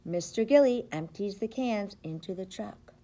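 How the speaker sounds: normal talking voice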